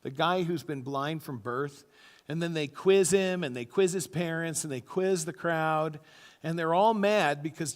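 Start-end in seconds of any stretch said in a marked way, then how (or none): none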